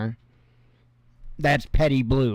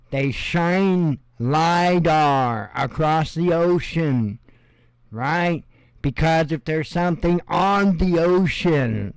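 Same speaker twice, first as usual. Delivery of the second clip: slow explaining; slower than usual. Shown as a demonstration. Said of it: "slow explaining delivery".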